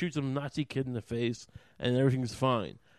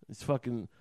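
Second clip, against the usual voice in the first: normal talking voice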